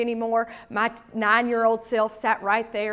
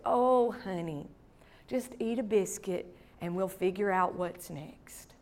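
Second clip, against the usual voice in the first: reassuring grandma; 3 semitones lower, 8 dB quieter than usual. Retold or imitated. Grandma soothing her heartbroken granddaughter, softly.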